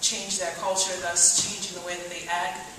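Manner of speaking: usual speaking voice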